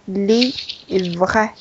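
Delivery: "le vrai" slowly, LE, then VRAI as one syllable; split before cluster. Shown as a demonstration. Teacher modelling the word, cluster kept in second syllable. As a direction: draw out le, keep vrai unbroken. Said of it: "然后vrai是一个音节".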